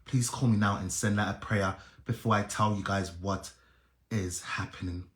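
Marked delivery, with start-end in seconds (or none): none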